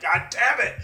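high-pitched mock frustrated yell; mock outburst. Imagining Becky losing it, mock exasperated outburst. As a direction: burst out high in pretend fury. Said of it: "she was like".

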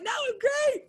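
brightly mask the panic inside; fake cheerful. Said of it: "I was smiling".

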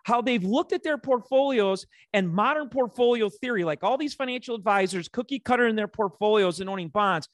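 high-pitched, fed-up soapbox tirade; soapbox rant. Real, not acted. Financial host fed up with advisors pushing bonds, soapbox rant. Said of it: "I'm on a soapbox today".

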